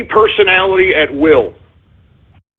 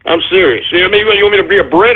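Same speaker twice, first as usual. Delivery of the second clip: usual speaking voice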